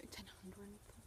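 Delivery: barely audible, voiceless whisper; whispered aside. Real, not acted. Vlogger repeats her own odd phrase to herself, whispered. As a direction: whisper it under your breath.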